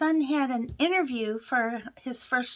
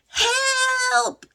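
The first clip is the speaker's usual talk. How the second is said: high, drawn-out mock scream for help; retold loud scream; 11 semitones higher, 4 dB louder than usual. Woman stuck in the washer screams again to sleeping family, mock scream. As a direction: stretch one high cry, acting out panic. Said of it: "as loud as I could".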